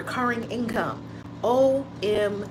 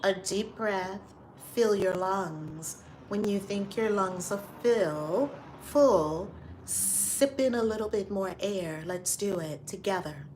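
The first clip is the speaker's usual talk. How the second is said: guided breathing; 4 dB quieter than usual. Acted. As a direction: lead them calmly through each breath. Leads a breathing exercise.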